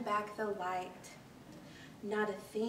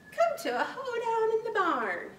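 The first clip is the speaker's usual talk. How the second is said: higher, louder festive call; calling to the hoedown; 10 semitones higher, 4 dB louder than usual. Acted. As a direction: call everyone to the dance, laughing.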